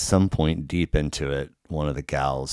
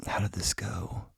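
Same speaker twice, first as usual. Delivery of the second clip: whispered aside; 2 semitones higher, 8 dB quieter than usual. Real, not acted. Thinking aloud mid-story.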